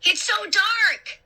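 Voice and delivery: high, drawn-out cry of alarm; stretched, high-pitched frightened shout. A narrator's reading. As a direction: wail it slowly in panic. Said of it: "Luke shouted".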